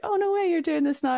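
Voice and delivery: high excited surprise. Retold or imitated. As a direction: sound thrilled and amazed. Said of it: "he was like".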